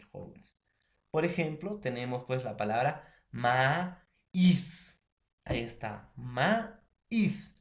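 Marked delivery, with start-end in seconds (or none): 3.3-5.0 "maíz" slowly split as ma-íz; stress on the Í. Shown as a demonstration. Teacher giving an example word, "maíz" split with stressed í. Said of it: "la vocal cerrada tiene mayor fuerza". 6.2-7.5 "maíz" in two separate beats, ma-íz; stress on the Í. Shown as a demonstration. Teacher showing the broken diphthong, "maíz" split before stressed í. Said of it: "la vocal cerrada tiene mayor fuerza".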